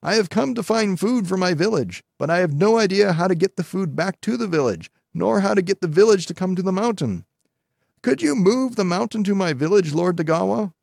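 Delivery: put-on high-pitched little girl pleading; high girlish voice, earnest appeal to a god; little girl's plea. A narrator's reading. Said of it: "cried the little girl".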